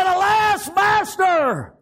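high, loud wail of alarm; alarmed cry. Acted. Servant cries out to his master in alarm. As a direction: cry out for help.

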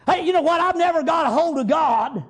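ordinary delivery

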